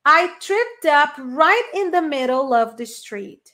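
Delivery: slow, clear English model; slow model sentence. Shown as a demonstration. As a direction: read it slowly for students to repeat. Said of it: "Listen and repeat". (frase modelo lenta em inglês)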